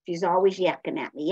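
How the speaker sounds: usual speaking voice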